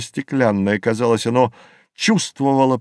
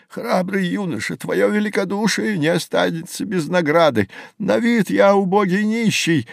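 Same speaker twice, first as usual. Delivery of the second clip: put-on high, steady old man's voice; grateful old beggar; 8 semitones higher than usual. Rescued old man promises his young saviour a reward, high grateful voice. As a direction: pitch it up high, an old man giving solemn thanks.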